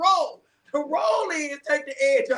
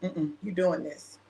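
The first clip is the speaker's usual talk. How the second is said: low, slow imitation of a stern God; a hint of God laying down the law; God's low voice; 8 semitones lower, 5 dB quieter, slower than usual. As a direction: drop low and slow as God overruling her. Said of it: "God was like".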